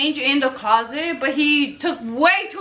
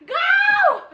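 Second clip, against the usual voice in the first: much higher, loud yell; urgent shout; 3 dB louder than usual. Retold or imitated. Girlfriend yelling at boyfriend to grab clothes and go, shouting. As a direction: hurl the words after him.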